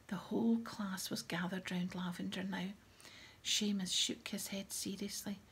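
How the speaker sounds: usual speaking voice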